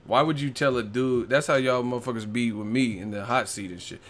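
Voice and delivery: ordinary talk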